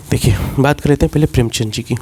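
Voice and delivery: ordinary talk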